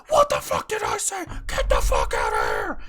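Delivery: loud, explosive dad yell; furious screaming. Retold or imitated. Dad spotting kids lingering at the door, screaming. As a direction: roar it with all your rage. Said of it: "Dad is fucking screaming".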